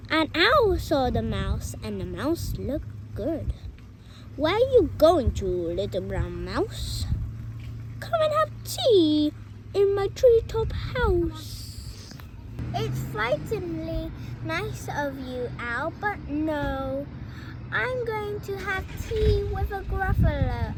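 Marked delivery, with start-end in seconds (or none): 4.3-12.1 lightly voiced owl, louder; a hint of a hooting owl inviting the mouse. 12.7-20.7 loud, bold little mouse; little mouse boldly turning down the fox, loud; brave mouse voice. Acted. Mouse refuses owl.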